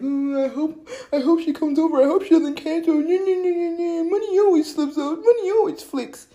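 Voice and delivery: high, loud mocking whine; whiny worrying. Friend fretting that plans get cancelled, mock whining.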